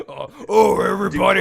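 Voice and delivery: loud booming announcer shout; monster truck ad. Pretend. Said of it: "Do it in a monster truck voice".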